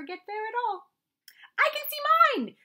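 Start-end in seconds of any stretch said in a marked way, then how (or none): 1.6-2.6 high, loud squeal of joy; excited cry. Acted. Little Roo cries out to friends at the bridge, high and excited. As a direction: Roo shrieks, sure he has spotted his stick. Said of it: "cried Roo".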